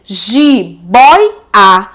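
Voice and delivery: "Jiboia" split as ji... boi... a; "Jiboia" in three separate beats, boi rising; syllable by syllable. Shown as a demonstration. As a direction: stretch boi in "Jiboia", with gaps round it. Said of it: "Veja que o oi".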